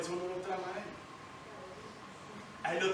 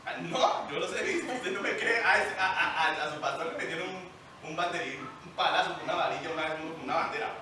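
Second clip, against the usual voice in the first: ordinary talk, nothing marked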